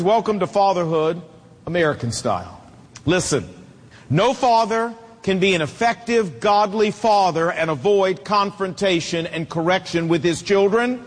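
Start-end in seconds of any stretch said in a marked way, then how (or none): none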